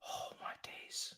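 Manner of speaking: breathless whispered oh my days; real stunned whisper. Real, not acted. Quiet stunned aftermath.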